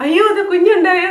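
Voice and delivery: high and loud, caught off guard; a father suddenly handed his newborn, shocked and loud; surprised dad. Retold or imitated. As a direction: blurt it out like a stunned father. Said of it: "അപ്പോൾ പപ്പ".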